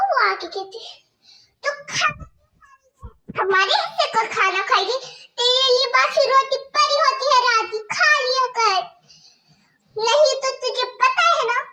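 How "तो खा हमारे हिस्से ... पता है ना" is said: higher, louder mocking stepmother voice; harsh stepmother scolding. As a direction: bark orders at the girl like a cruel stepmother. Stepmother about stale bread.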